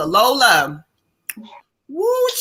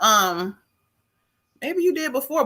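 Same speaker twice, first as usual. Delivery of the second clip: ordinary delivery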